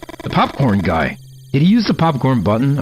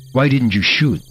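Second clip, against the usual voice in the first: usual speaking voice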